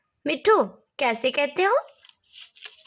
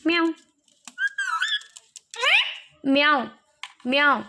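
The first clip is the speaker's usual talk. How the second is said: mock meowing to scare the parrot; playful imitated meow; cat meow. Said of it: "वो देखो बिल्ली आ रही है".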